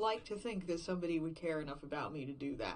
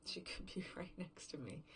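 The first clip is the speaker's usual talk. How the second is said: hushed whisper; 7 dB quieter than usual. Acted.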